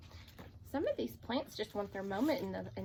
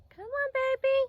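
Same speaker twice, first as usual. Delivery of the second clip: coaxing the hen; 8 dB louder than usual. Real, not acted. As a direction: sweet-talk the hen in, up high. Coaxing the chicken.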